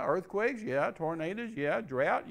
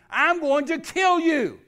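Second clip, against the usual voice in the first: high, loud, slow scream; screamed threat; 8 semitones higher, 10 dB louder, slower than usual. Retold or imitated. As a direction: yell it like a gunman. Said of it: "he screams at me".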